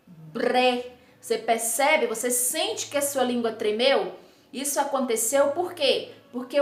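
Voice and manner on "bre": BRE with a vibrated R after the B; isolated "breh", R clearly heard; tongue trembles. Shown as a demonstration. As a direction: repeat BRE as a sound demo, tongue trembling. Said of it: "aí a minha língua vai tremer".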